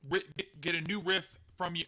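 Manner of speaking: ordinary delivery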